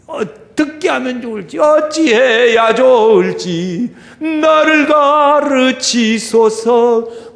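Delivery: slow, loud drawn-out prayer cry; pleading prayer. Acted. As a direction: stretch each word out like a desperate prayer. Acted earnest prayer plea.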